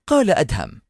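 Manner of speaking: ordinary delivery, nothing marked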